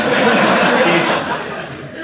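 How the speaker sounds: short real laugh at himself; a real chuckle mid-story. Real, not acted. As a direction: laugh it off at your own expense.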